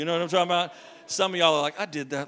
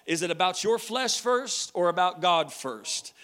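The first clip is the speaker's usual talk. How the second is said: usual speaking voice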